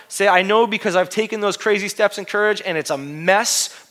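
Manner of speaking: loud, high, fired-up sermon; full-force preaching. Acted. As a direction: preach it out to the whole room.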